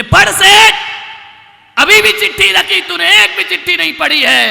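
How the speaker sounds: loud, high rebuke; scolding shout. Acted. Yamraj scolding the seth for unread letters, loud. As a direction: berate him for ignoring letters.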